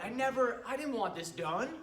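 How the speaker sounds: mock the outraged client denying the job; upset client. Client calling back to complain about the job, indignant. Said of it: "they're like".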